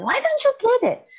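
put-on high shouted frustration at her kids; exasperated mom's shout; retold scream. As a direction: yell it at the kids who won't get the math. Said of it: "I would scream and yell".

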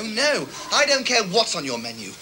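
ordinary talk, nothing marked

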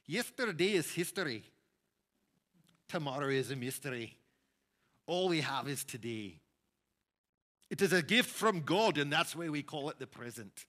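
loud, bright Scottish boss voice with rolled phrasing; a Scottish boss's saying, proudly declaimed; Scottish accent. Retold or imitated. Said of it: "so I'll say this with a bit of an accent".

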